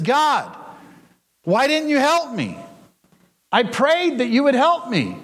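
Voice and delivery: a hint of a wounded, accusing man; aggrieved man. A narrator's reading. Drowned man complains to God.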